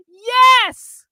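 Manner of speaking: high, loud shout of joy; joyful cry. Acted. Carlin cries out in joy to James. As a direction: burst out in triumph. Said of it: "Carlin cried tears of joy".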